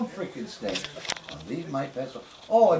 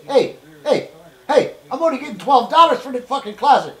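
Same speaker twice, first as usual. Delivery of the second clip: boss yelling; 4 semitones higher, 6 dB louder than usual. Retold or imitated. Boss dad yelling at worker.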